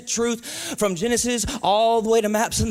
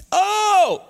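high, loud "Oh!"; amplified exclamation; 10 semitones higher, 6 dB louder than usual. Shown as a demonstration. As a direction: belt out the word, high and big. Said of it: "just to amplify it".

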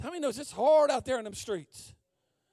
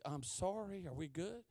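very soft, lowered voice; sheepish apology; 4 semitones lower, 13 dB quieter than usual. Retold or imitated. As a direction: apologize meekly, almost under your breath. Sheepish apology to drive-thru worker.

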